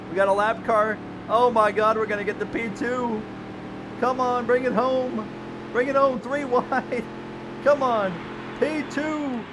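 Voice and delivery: high, loud cheering, building; excited yelling. Real, not acted. Streamer cheering his car toward second place, loud excited yelling. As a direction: urge the car home at the top of your voice.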